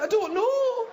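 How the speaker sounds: very high comic wail; mock-distressed girlfriend; distraught woman. Woman explaining the collapse, upset.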